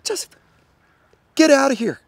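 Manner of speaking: high, loud exasperated order; angry dismissal. Acted. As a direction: send him away, voice jumping up. Ordering scout to leave.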